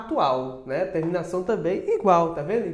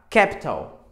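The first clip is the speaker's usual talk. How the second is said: British "capital", louder, with a crisp true t; British pronunciation; 2 semitones lower, 5 dB louder, slower than usual. Shown as a demonstration. Teacher showing the T sounds like T, British pronunciation. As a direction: say "capital" British style, KAP-tul. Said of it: "No britânico você usa capital".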